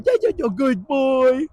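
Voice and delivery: put-on slow, sing-song praise for a good boy; doting dog praise. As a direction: coo at the dog like a proud owner. Owner praising dog in example.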